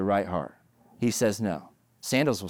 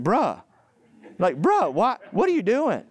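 high, louder mock teen "bruh"; kid slang; 10 semitones higher, 3 dB louder than usual. Pastor voicing his kids scolding Ananias, mock exasperated teen. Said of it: "That's what my kids would say".

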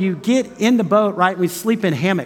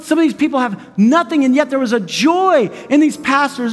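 much higher, raised excited report; excited retelling; 7 semitones higher than usual. Retold or imitated. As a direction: lift it high as they rush to tell you. Team's excited report retold.

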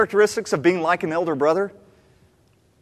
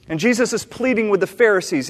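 normal talking voice

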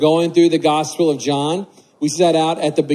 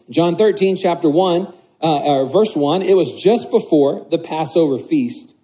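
scripture reading; 3 semitones lower than usual. Acted. Reads the scripture passage aloud.

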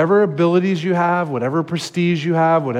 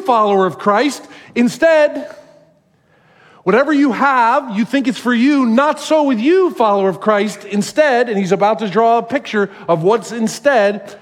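preaching at full force; 6 semitones higher than usual. Acted. Pastor preaches Jesus' teaching on servanthood, forceful and high. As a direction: hammer home the contrast like a revival preacher.

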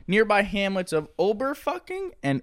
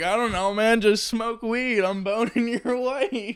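higher, slow stoner drawl with a laugh; mock laid-back doctor; 7 semitones higher, slower than usual. Mocking the doctor who slept with a patient's wife, higher and slow. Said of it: "He's like".

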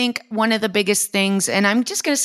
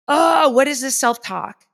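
higher-pitched, lightly scoffing groan; mild mock exasperation; 7 semitones higher than usual. Trainer admitting self-talk sounds cheesy, mild mock exasperation. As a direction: lightly mimic your own eye-rolling doubt.

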